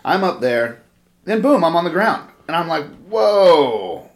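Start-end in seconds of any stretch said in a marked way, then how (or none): 3.1-4.1 dazed, startled whoa, louder; a sudden stunned gasp of a word. Retold or imitated. Dazed after knee to head.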